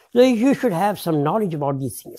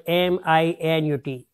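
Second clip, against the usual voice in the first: ordinary talk, nothing marked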